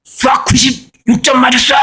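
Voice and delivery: high, thrilled shout of good news; a father imitating his thrilled daughter's high shout; excited daughter shouting. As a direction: crow about the test score. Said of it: "막 저한테 막 소리 지르고".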